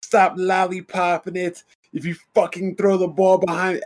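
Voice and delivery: high-pitched retold dad's scolding; imitating yelling dad. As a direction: mimic a hard-ass father barking orders. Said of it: "yelling at me".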